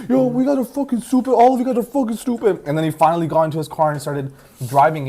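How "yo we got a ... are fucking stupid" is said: high, raised-voice imitation of an angry driver; worked-up mock tirade; retold angry outburst. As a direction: lash out at everyone, mimicking him. Retold driver lashing out at everyone.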